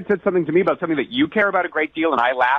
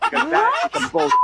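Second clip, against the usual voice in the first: laughing outrage. Real, not acted.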